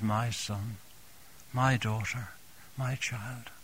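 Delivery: tender, softening. Acted. Preacher voicing God to the congregation, softly tender. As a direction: let each name fall gently, like an embrace. God voiced embracing his children.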